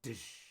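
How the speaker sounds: imitated spotlight slam, "dish"; voiced sound effect of a light switching on; second spotlight clunk. A narrator's reading. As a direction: make another sudden hissing impact noise. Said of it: "And then another".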